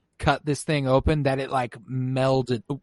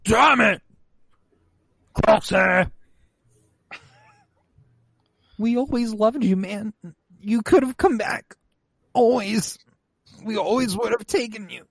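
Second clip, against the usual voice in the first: pleading Wrecker; 8 semitones higher than usual. Retold or imitated. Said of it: "Wrecker's like".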